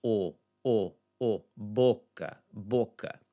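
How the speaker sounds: deliberate "o, o, o" drill into "boca"; first-syllable O. Shown as a demonstration. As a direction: isolate "o", then "boca". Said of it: "focus on the first syllable".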